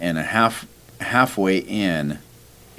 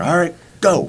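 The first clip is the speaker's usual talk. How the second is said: starter's call; 11 semitones higher, 5 dB louder than usual. Retold or imitated. Race starter sending off crowd.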